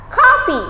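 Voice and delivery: coffee said as kafi, high and clear; model pronunciation. Shown as a demonstration. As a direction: say coffee as KAH-fee with a bright falling tune. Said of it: "Kita bisa baca". (coffee diucapkan kafi, nada tinggi lalu turun)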